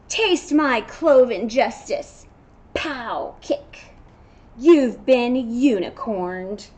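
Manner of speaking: loud, slow superhero bravado; kids' superhero play voice; heroic goat and unicorn. Acted. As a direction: ham up a comic-book rescue.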